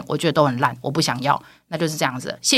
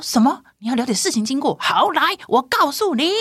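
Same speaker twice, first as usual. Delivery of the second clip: defiant retort; 8 semitones higher than usual. Retold or imitated. She challenges the chairman.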